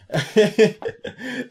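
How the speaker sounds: bursting out laughing; a big amused cackle; real laugh at the joke; loud high laugh. Real, not acted. Laughs at own impression.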